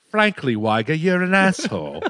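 playful put-on British accent. Warning Wags not to bother him, in British accent. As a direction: borrow the line in a mock British accent. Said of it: "I gotta use that".

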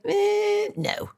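dismissive no. Retold or imitated. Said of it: "I'm like".

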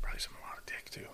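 soft hushed whisper. Real, not acted. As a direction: breathe it as a cheeky secret. Whispered crude joke.

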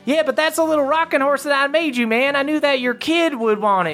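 high, fast folksy elf voice; crick elf. Acted. Crick elf offers a gift for a kid, high and quick. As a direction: rattle it off as a high little elf. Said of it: "another crick elf, like, walks over".

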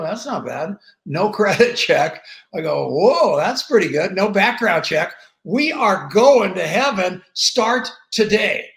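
building from pleased to loud, thrilled; getting carried away reading the ad; excited. Retold or imitated.

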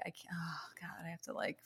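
whispered despairing aside; whispered panic. Retold or imitated. Designer dreading the sales meeting, whispered despair. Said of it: "I'm like".

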